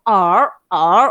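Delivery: er pressed hard with a heavy r, loud; stressed, strongly r-coloured er; Beijing-accented er. Shown as a demonstration. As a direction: lean on the r in "er", Beijing style. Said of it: "dia lebih sikit tekankan sikit".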